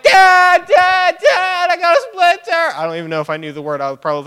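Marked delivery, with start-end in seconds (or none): 0.0-2.8 put-on high, loud little kid yelling for dad; child calling out. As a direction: shout for dad like a hurt five-year-old. Kid calling father for help.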